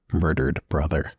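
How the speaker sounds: normal talking voice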